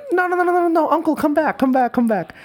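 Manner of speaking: high frantic mock yell of "come back"; mock yelling vendors. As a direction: vendors calling out in a high panic to stop the customer. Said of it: "this time they're yelling".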